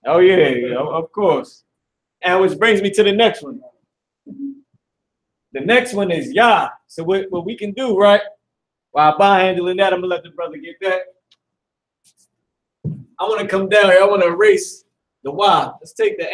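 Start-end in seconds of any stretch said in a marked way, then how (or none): none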